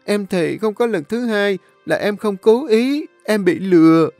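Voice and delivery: high, whining, tearful plea; cornered girl begging the young master, wailing; wailing loudly. A narrator's reading. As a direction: wail and blame the other man. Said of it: "nó liền bù lu bù loa".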